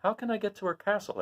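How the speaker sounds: put-on high, soft girl voice; an eager child asking; Dorothy. Dorothy asking the way to Glinda. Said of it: "asked Dorothy".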